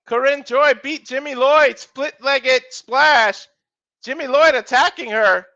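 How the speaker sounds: rapid ringside calls rising on big moves; live play-by-play. Acted. Commentator calling a wrestling match to viewers, energetic play-by-play. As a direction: call the match as it happens.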